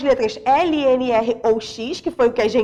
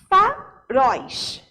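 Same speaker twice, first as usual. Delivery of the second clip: "Faróis" split as FA... RÓIS, very slow; syllable by syllable; much slower than usual. Shown as a demonstration. Teacher showing students the stressed last syllable of "Faróis", slowly split FA-RÓIS. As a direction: pull "Faróis" apart, landing on final RÓIS. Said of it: "última sílaba sendo falada com mais força".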